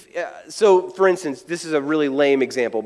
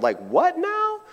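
mock confusion; 11 semitones higher, 4 dB quieter, slower than usual. Listeners reacting to John's strange description, comically baffled. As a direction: voice leaping up high in disbelief.

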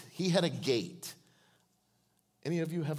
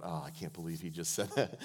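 imitating Russell, laughing; 9 semitones lower, 6 dB quieter than usual. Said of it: "He's gonna be shaking his head going".

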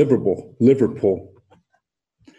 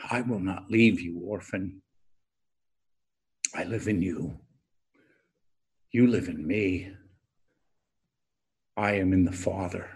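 soft, low and intimate, almost to himself; tender hush; 4 semitones lower, 8 dB quieter than usual. Acted. Preacher repeating Jesus' promise to the congregation, hushed and tender. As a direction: lay the promise down gently, barely voiced.

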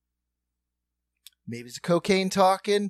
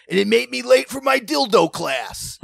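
loud, high comic payoff; Norm Macdonald; 8 semitones higher, 8 dB louder than usual. Retold or imitated. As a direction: deliver a mock-indignant punchline. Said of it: "pay off with the Norm Macdonald bit".

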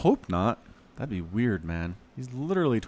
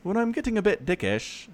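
higher-pitched imitation British voice; mock British accent; 9 semitones higher than usual. Podcaster mimicking an English actor's phrase, mock British accent. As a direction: lightly put on a British accent. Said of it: "you sound way more British".

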